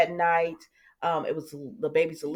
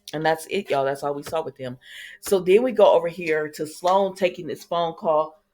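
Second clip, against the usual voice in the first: ordinary talk, nothing marked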